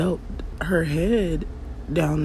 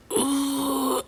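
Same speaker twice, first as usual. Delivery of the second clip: pushing groan; 12 semitones higher than usual. Retold or imitated. Pushing during labor.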